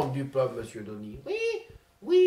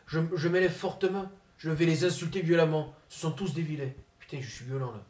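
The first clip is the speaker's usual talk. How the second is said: Zinedine Zidane impression; 2 semitones lower, 7 dB quieter than usual. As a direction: mumble like a shy, sulky footballer. Comedian impersonates Zinedine Zidane.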